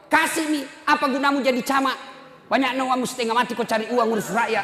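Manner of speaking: high, loud scolding of her husband; mother berates the father; angry mother. Retold or imitated. A mother scolds her husband for refusing the child. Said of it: "Langsung datang mamanya".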